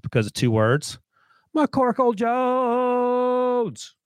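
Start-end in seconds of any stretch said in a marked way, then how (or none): 1.6-3.9 high-pitched impression, very drawn out; Mike McDaniel. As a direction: stretch the name out long, held on one high note. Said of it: "Mike McDaniel's impression".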